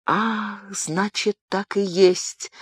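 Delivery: the truth hits her all at once; exclamation. Acted. Diana, realising the secret, exclaims to Gabriel. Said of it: "воскликнула Диана".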